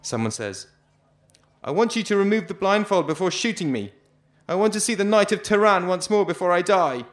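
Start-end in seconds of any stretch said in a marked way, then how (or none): 1.6-7.1 high, much louder plea; a condemned man begging before execution; desperate last plea. Acted. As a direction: call out a final wish.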